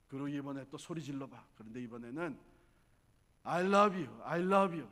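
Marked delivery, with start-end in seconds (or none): 3.4-4.9 loud shout of love. Shown as a demonstration. Mother showing her daughter what to shout, loud. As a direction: call it out loud. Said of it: "하면 소리 질러봐".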